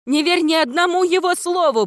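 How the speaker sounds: very high, urgent cry; desperate high-pitched shouting; screamed warning. A narrator's reading. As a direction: scream for a friend to flee. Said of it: "прокричала Виола".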